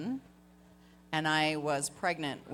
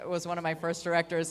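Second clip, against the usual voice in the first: ordinary delivery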